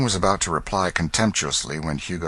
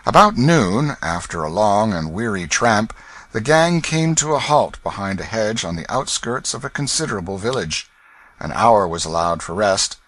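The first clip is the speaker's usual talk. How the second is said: ordinary delivery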